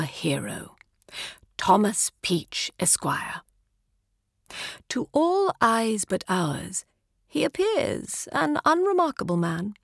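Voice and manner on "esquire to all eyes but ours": usual speaking voice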